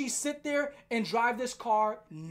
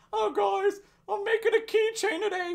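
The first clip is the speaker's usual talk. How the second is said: mock cheery vlogger; 8 semitones higher, 3 dB louder than usual. Mocking his cheery video voice.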